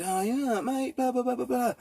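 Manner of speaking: mock chatty neighbour voice; high mock chatty stranger. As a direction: parody an overfriendly neighbour nattering on. Mocking chatty van neighbour.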